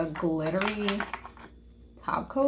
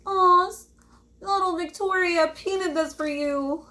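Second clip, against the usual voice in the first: high, gushing mock voice; put-on high sweet presenting voice; someone presenting child's painting; 11 semitones higher, 4 dB louder than usual. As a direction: coo it much higher, as if showing off a kid's artwork. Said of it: "it's someone's child who painted this".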